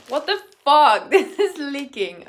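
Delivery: startled outburst. Real, not acted. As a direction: burst out in loud disbelief. Startled by something leaking.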